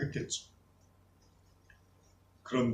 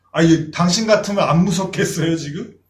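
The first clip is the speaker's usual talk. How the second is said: sarcastic disciple; 3 semitones higher, 8 dB louder than usual. Retold or imitated. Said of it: "이랬을 거예요".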